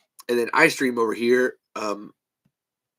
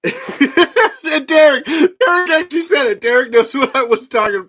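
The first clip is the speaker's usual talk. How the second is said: laughing helplessly; 11 semitones higher, 9 dB louder than usual. Real, not acted. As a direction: laugh through the words, near crying. Breaks up laughing.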